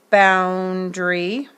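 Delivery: boundary said slowly, leaning on the ow; slow sounding out. Shown as a demonstration. Teacher linking the ow sound to the word.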